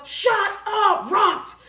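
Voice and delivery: high, drawn-out imitation of his yelling; mock yell. Woman mimicking angry man yelling at her, high mock yell. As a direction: mimic his yell without real volume. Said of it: "the more he yell".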